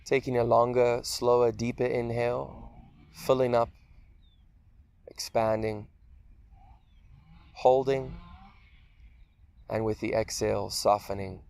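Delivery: unhurried meditation voice with long pauses; guided meditation. Acted. Teacher leading listeners through a guided meditation, calm and spacious. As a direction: guide them gently through a body scan, leaving space between phrases.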